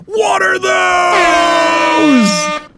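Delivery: very slow, high, loud screech; high drawn-out screeching wail; drawn-out mock shout. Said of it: "He get off the fucking private jet".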